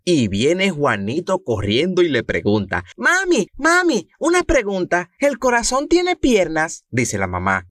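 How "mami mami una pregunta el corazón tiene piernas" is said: put-on high little boy asking mom; squeaky kid voice, much higher; child voice. Niño Juanito le habla a mamá. (voz de niño pequeño, mucho más aguda)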